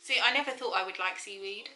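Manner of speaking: face covered in wet mask; speaking with stiff cheeks; through a sheet mask. Real, not acted. Seen 0: Left speaker wears sheet mask on face throughout; mouth opening visible.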